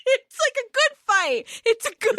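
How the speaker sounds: high-pitched, laughing as you speak; real laughter. Real, not acted. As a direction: get it out through the giggles. Cracking up over the fight.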